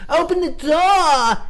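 high, slow mock holler; drawn-out demand; oblivious calling out. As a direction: imitate a student yelling through a door. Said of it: "he wasn't listening".

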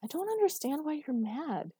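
high, carefully enunciated protest; clear, high-pitched bewilderment; very clearly spoken. A narrator's reading. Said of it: "she said very clearly".

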